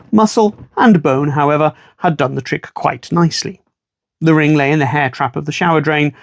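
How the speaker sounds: ordinary delivery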